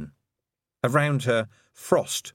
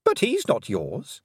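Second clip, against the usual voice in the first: higher, silky voice of a mocking fairy queen; lightly higher, condescending queen; 7 semitones higher than usual. A narrator's reading. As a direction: purr it out as the cruel Queen, sweetly taunting.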